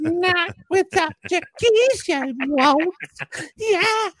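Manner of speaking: put-on high, slow quavery old lady; old person voice. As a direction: croak it out like a frail old granny. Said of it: "you gotta do that old person voice".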